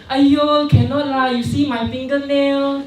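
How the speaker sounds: put-on high, whiny girlish complaint. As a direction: act out a fussy girl showing her nails. Girl quoted complaining.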